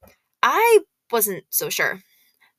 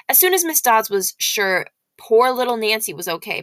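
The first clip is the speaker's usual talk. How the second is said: plain reading voice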